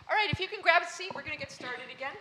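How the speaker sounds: host calling the crowd back to their seats; calling out to the room. Real, not acted. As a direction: get the room's attention quickly.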